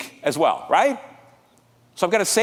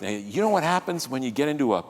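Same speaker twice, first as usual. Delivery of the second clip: ordinary talk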